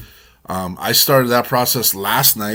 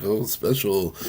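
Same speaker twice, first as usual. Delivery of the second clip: mocking; 3 semitones lower, 5 dB quieter than usual. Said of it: "people are like".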